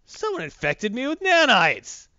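high, slow, loud hammed-up declaration; theatrical heroine's outcry; mock outraged discovery. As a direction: swell into a drawn-out comic-book exclamation. Voicing heroine's angry discovery.

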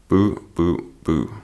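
lightly voiced backing-singer boos; mock booing. Shown as a demonstration. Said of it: "the boo, boo, boo".

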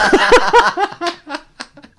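high loud laughing fit; cracking up hard; loud burst of laughter. Real, not acted. Big laugh heard.

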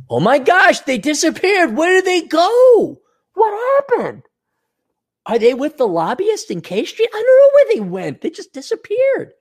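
high, sing-song fake innocence; mock innocent. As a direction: play dumb in a high falsetto. Mock innocent cop after disappearances.

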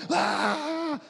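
put-on high wailing cry of a hungry baby; Portuguese: choro de bebê, agudo. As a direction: imitate a newborn crying, high. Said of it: "o choro de uma criança que chora com fome".

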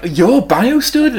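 high, drawn-out incredulous question; astonished recognition. Retold or imitated. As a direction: act out a stunned discovery, slowly and high. Acting out Zelda's shocked recognition.

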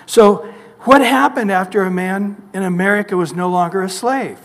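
usual speaking voice

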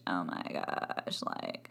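very low, slow, creaky fry; vocal fry. Shown as a demonstration. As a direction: drop into a lazy vocal fry. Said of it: "the Kardashian mode of speech".

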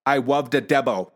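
put-on "love the Debo" as a toddler's "wuv de debbo"; speech impediment. Dad echoing his four-year-old, "love" mispronounced as "wuv". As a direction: turn the L of "love" into W, "Debo" for devil. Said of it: "I'll say it the way he said it".

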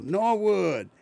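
high, loud mock kids' yell; imitating Southern kids hollering across the yard. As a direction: call out like the little neighbour kids. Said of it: "they would yell".

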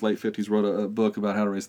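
usual speaking voice

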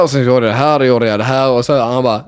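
ordinary talk, nothing marked

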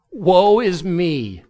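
drawn-out, slow mock lament. Preacher quoting Micah's catchphrase, slow mock lament. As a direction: moan it slowly like a miserable prophet. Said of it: "here's his catchphrase".

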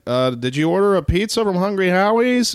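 high, timid calling out; nervous delivery guy. Retold or imitated. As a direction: call out nervously. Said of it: "And I'm like".

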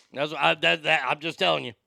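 usual speaking voice

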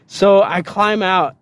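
usual speaking voice

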